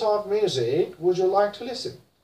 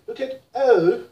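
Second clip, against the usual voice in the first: ordinary delivery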